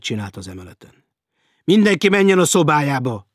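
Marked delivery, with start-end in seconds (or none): 1.6-3.3 high, loud captain's order; captain shouting at a crowd; booming shout. A narrator's reading. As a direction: bellow an order to clear the floor. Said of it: "harsogta a kapitány".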